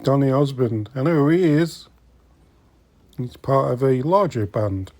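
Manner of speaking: normal talking voice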